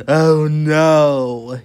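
loud, drawn-out groan of horror; gasped dismay. Acted. Mister Fussy finds his bathroom, horrified loud gasp. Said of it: "he gasped".

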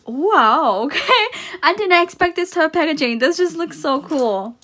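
loud, high, delighted gush; excited. Real, not acted. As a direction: burst out with delight. Unexpected packaging.

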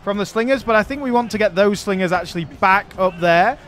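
ordinary delivery

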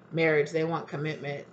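usual speaking voice

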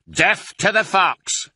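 slow, high battle cry; drawn-out rallying shout; shouted war cry. A narrator's reading. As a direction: shout it out, drawing each word long. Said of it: "shouted Bunce".

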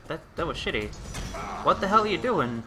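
normal talking voice

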